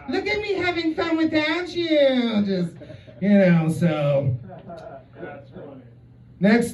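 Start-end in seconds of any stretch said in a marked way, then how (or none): 0.1-2.4 high, taunting singsong gloat; high mocking ex-girlfriend voice; jealous ex-girlfriend. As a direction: tease like a jealous ex showing off. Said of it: "like a jealous ex-girlfriend".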